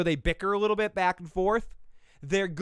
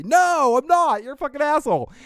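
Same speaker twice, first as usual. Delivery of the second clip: loud, high indignant shout; hammed-up furious rejection; mock outrage; 11 semitones higher, 9 dB louder than usual. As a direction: yell it back as the offended party. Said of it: "and they say".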